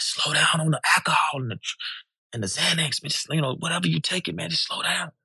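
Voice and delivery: soft, hushed friendly warning, voiced, not a true whisper; a bodyguard's quiet word, softly voiced and fading; retold whisper. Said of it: "he whispered in my ear".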